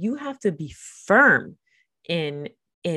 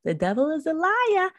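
much higher mock churchy lady voice; hammed-up lady blaming the devil; church lady; 10 semitones higher than usual. Mocking a Boston churchgoer who blames the devil, high and hammed-up. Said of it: "she was like".

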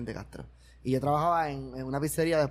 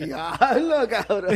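mock amazed owner; 6 semitones higher, 4 dB louder, much slower than usual.

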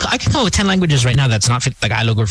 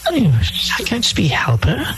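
high, haughty mock-rich voice; hammed-up snob, pitched high; snobbish employer; 7 semitones higher than usual. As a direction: play a disdainful rich boss looking down on maids. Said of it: "Where's this script from?".

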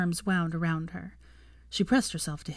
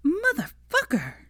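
hissed curse; 12 semitones higher, 4 dB louder, slower than usual. Acted. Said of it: "she hissed".

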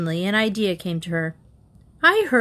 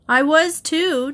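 high, drawn-out retort; exasperated; 9 semitones higher, 4 dB louder, slower than usual. A narrator's reading. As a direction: protest slowly, in exasperation.

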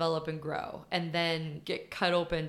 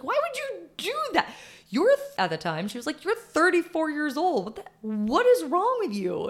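high, louder mock scolding; sister scolding; 10 semitones higher, 5 dB louder than usual. Imitating her sister scolding her, higher and louder. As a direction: play the outraged younger sister. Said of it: "She's like".

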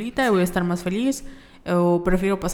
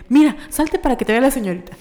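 higher, louder bossy mother; mocking mom; 6 semitones higher, 5 dB louder than usual. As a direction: show the saleswoman, humiliating the daughter. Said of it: "mi mamá así de que". (mamá mandona imitada, más aguda)

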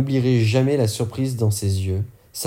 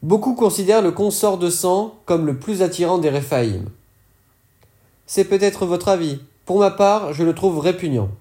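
higher dealer voice, disdainful at the end; higher, sneering dealer voice; character voice, higher; 8 semitones higher than usual. Acted. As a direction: let the disgust land on répugnant. Fourgueur giving his opinion.

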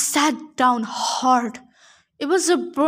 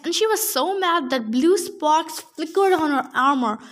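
usual speaking voice

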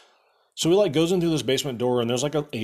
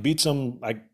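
ordinary delivery

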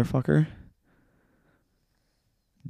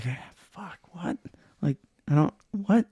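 retold bewilderment; 7 semitones higher than usual. Retold bewildered reaction to the breakdown.

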